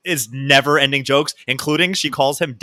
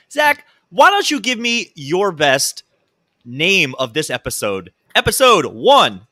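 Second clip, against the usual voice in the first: announcer voice; 3 semitones higher, 4 dB louder, slower than usual. Pretend. Hosting intro in announcer voice.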